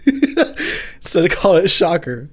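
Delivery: slow words broken by real laughter; cracking up. Real, not acted. Repeating his own joke, slowed and laughing. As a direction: laugh through the line. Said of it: "make myself laugh".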